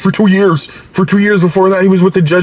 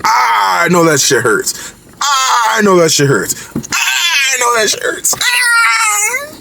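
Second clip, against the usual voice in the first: from muttered wince to a high mock shout of pain; mock pain cry; 5 semitones higher, slower than usual. Reacting to the knee to the jaw, a hammed-up pained yell that keeps rising. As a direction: build it up until he's yelling in fake agony. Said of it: "I was like".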